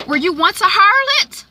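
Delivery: very high, loud mock shock; scandalized daughter. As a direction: play a daughter aghast at her mother. Daughter's shocked question, acted.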